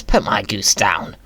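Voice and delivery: ordinary talk, nothing marked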